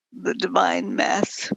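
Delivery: ordinary talk, nothing marked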